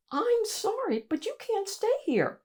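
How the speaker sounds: put-on high, small polite child voice; a tiny boy standing up to a giant; little Mo. Little Mo politely confronting the monster, high and small. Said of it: "said Mo".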